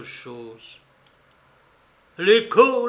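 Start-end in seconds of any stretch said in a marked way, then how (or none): 2.2-2.9 high, loud sudden recall; sudden exclamation. A narrator's reading. Silver suddenly remembering the spyglass, exclaiming. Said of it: "lança-t-il".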